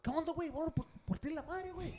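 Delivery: high-pitched mimicry of a fighter; acted-out street challenge; mock challenge. Acted confrontation in a retold fight.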